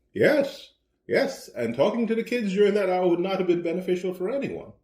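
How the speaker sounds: high-pitched, emphatic self-defence; retold defensive reply. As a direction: argue back in a retold marital spat. Retold quarrel, his defensive reply.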